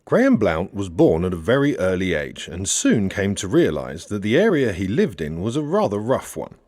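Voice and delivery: ordinary delivery, nothing marked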